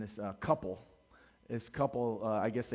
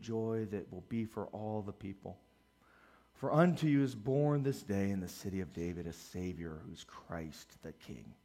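scripture reading; 4 semitones lower than usual. Acted. Pastor reading the angel's announcement, reverent scripture reading.

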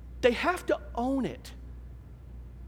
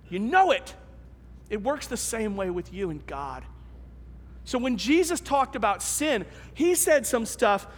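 ordinary talk

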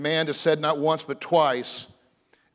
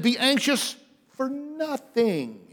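slow, higher be anxious for nothing; emphatic command; 8 semitones higher, slower than usual. Shown as a demonstration. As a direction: deliver it slowly and high. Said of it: "he repeats that in the negative".

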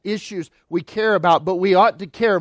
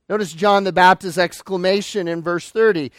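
usual speaking voice

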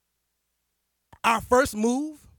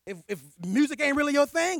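ordinary talk, nothing marked

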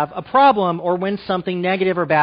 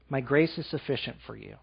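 much softer, lower echo of the words; softer, lower second take of My grace; hushed repeat; 5 semitones lower, 10 dB quieter than usual. Acted. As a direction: let it fall to a near hush.

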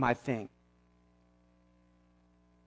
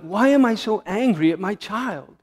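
ordinary talk, nothing marked

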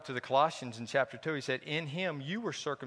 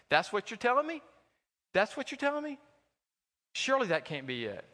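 much higher, rising incredulous question; mock disbelief; 7 semitones higher than usual. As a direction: scoff as if you can't believe it.

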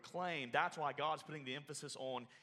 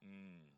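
quiet savouring hum; 8 semitones lower, 14 dB quieter than usual. Retold or imitated. Commercial guys eating quietly.

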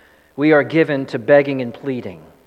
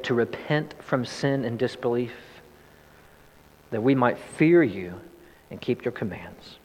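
pastoral prayer; 2 semitones lower than usual. Acted. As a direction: pray humbly for the hearers. Leads the congregation in prayer.

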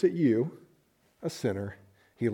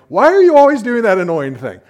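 much higher, louder, fed-up shout; exasperated yell; 9 semitones higher, 14 dB louder than usual. Retold or imitated. Several dates later, snapping at the partner, loud exasperated yell. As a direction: blow up at them after dates of the same habit. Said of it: "you're like".

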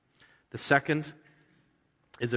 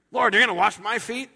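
high, loud disbelieving question as Peter; incredulous Peter; 11 semitones higher, 7 dB louder than usual. Retold or imitated. Preacher voicing Peter's shock at Jesus washing his feet, high and loud. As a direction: make Peter's outrage ring out high. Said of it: "so Peter just fires up".

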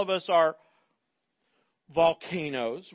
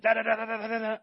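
rapid da-da-da mimicking gunfire; machine gun noise; 3 semitones higher, much faster than usual. Shown as a demonstration. Preacher to congregation, imitating machine gun fire. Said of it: "They're the machine guns".

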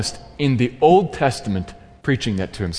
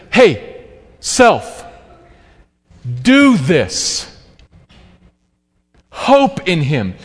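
firm self-exhortation; 3 dB louder, much slower than usual. Acted. Psalmist grabbing himself by the lapels, firm and raised. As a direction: shake yourself awake. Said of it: "He's taking himself and saying".

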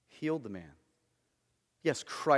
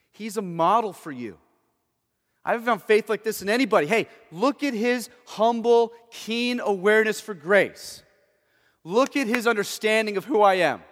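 Jesus rebuking crowd; 3 semitones higher, 8 dB louder than usual. Retold or imitated. Preacher voicing Jesus to crowd.

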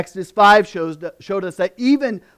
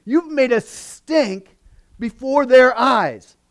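higher, louder, mock accusing outburst; hammed-up anger at Moses; the people blaming Moses, higher and indignant; ticked off; 5 semitones higher, 4 dB louder than usual. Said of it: "They were ticked off".

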